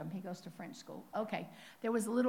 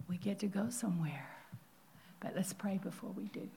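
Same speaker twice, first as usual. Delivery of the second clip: whispered softly into the prayer; 2 semitones lower, 5 dB quieter than usual. Acted. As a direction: hush them before praying. Preacher quietly leading into prayer.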